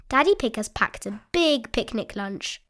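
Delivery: ordinary delivery, nothing marked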